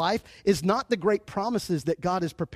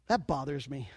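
ordinary talk, nothing marked

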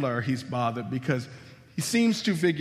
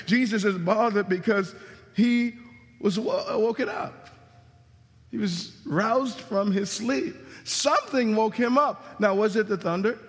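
preaching; 6 semitones higher than usual. Acted. Preacher stressing to the congregation why Jesus woke, emphatic. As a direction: drive the point home to the room.

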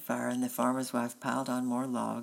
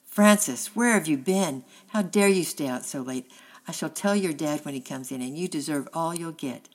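loud, high, upset shout down the stairs; a mother scolding from upstairs, close to tears; mother calling out, tearful and furious; tearful angry call; 3 semitones higher, 9 dB louder than usual. A narrator's reading. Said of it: "tearful and angry, called".

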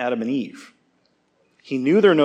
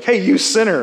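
louder, higher jab of "Hey, you sinner!"; mock accusation; 6 semitones higher, 5 dB louder than usual. Telling the church how not to rebuke, a mocking call-out. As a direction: call your buddy out with a teasing bark. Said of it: "be like".

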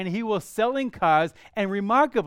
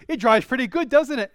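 imitating an upbeat sales pitch, higher and louder; car salesman; 5 semitones higher, 6 dB louder than usual. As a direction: plant the suggestion like a salesman, lifted and loud. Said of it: "he goes".

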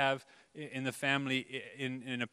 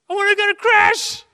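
put-on shrill, much higher, much louder child's yell; kid's shriek; 16 dB louder than usual. Kid busts out during quiet worship.

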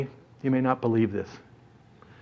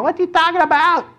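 very high, squeaky bewilderment; baffled outburst. Acted. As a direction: jump way up in pitch, utterly baffled. Baffled kids repeating the question, escalating.

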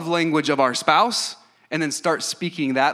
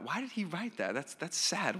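much softer, puzzled murmur; hushed parental concern; 4 semitones higher, 11 dB quieter than usual. Retold or imitated. Parents puzzling over their son's answer, hushed and concerned. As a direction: imitate worried parents wondering quietly.

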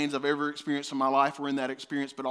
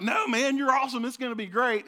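higher, louder mock cheer; hyped-up encouragement; 7 semitones higher, 7 dB louder than usual. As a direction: play the cheering fan who says go for it. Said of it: "Expecting him to be like".

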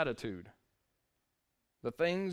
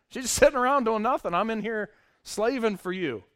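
mock indignant complaint; 6 semitones higher, 8 dB louder than usual. Voicing Martha's complaint.

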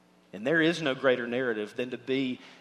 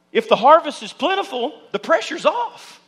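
higher and louder, proclaiming; rising preaching emphasis; 10 semitones higher, 9 dB louder than usual. Real, not acted. Pastor to congregation, lifting the burden, loud and higher. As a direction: lift it like good news. Preacher drives home his point.

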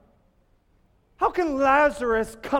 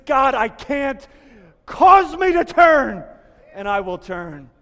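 rebel's desperate cry; 2 semitones higher, 10 dB louder than usual. Acted. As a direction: cry out Cause me to turn from the gut. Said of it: "you know the cry of the rebel".

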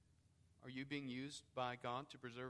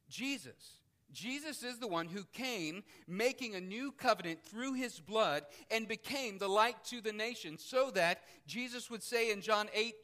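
louder, higher, ringing out each phrase; preaching at full force; 6 semitones higher, 10 dB louder than usual. Acted. Preacher reading scripture to the congregation, forceful preaching. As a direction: proclaim it to the whole room.

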